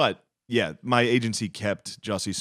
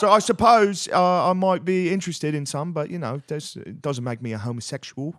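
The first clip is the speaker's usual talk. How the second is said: mock British accent; 7 semitones higher than usual. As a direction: hedge along in a put-on British accent. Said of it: "just British people talking like".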